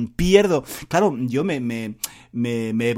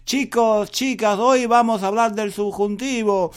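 teacher greeting the class; 7 semitones higher, 5 dB louder than usual. Retold or imitated. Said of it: "Hoy yo llego a clase y digo".

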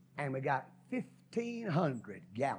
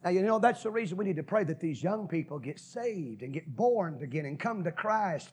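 normal talking voice

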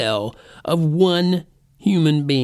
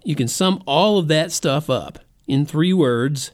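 usual speaking voice

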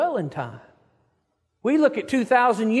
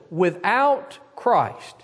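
slow, heavy weight on without Christ; stress on without Christ; 3 semitones lower, much slower than usual. Shown as a demonstration. Preacher to the congregation, underscoring without Christ slowly. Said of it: "and underscore this".

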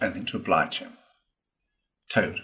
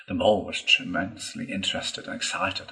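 usual speaking voice

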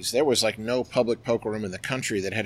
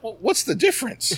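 rhetorical retort; 12 semitones higher, 4 dB louder than usual. Retold or imitated. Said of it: "I'm like".

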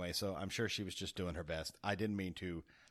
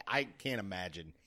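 ordinary talk

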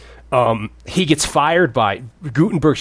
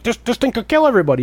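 mock panic; 11 semitones higher, faster than usual. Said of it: "Where everyone's like".

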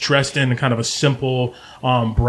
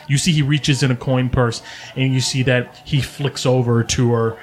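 ordinary talk

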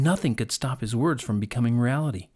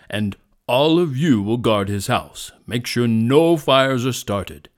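loud, powerful lion with firm authority; commanding lion; 6 dB louder than usual. A narrator's reading. Lion stops animals and gives orders, commanding. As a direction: take charge like a king.

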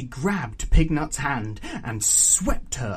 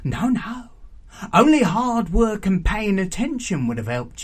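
bossy aunt, emphatic; stern raucous auntie; 6 semitones higher, 6 dB louder than usual. A narrator's reading. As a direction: lecture the girl in a higher, louder character voice. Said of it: "approaching Pignut menacingly".

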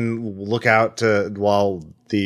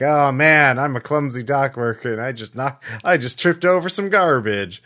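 high, hammy klutz voice, overacted; hammed-up clumsy dock worker; 6 semitones higher than usual. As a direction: play up the oblivious clumsy worker act. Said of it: "like, very slowly and exaggeratedly".

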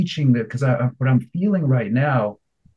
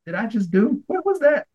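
surprised at himself; 10 semitones higher than usual. Retold or imitated. Amazed at himself.

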